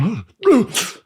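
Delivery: a high, panicked gasp for air, as if coming up choking; sharp gasp. Retold or imitated. Recalling choking in the pool, a sharp high gasp. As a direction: gasp like you just swallowed pool water.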